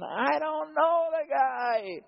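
high, slow, dismissive denial; Peter denying. Acted. Peter brushing off Jesus, high and slow. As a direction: brush him off like a stranger.

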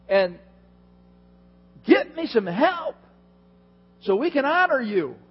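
high, loud, frantic demands; Martha losing it. Retold or imitated. Preacher voicing Martha ranting to Jesus, high and frantic. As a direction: push Jesus to fix it now. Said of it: "she just loses it and says".

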